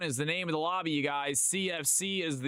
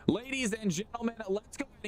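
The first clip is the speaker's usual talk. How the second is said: even higher showman call; hype announcer, higher; 8 semitones higher than usual. Acted. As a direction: lift the call up for the crowd. Restarting hype intro.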